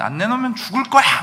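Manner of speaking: hammed-up wife's ultimatum to her husband; threatening. Pastor voicing Rachel threatening Jacob for a child, mock threat. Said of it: "막 이러면서 협박을 하고".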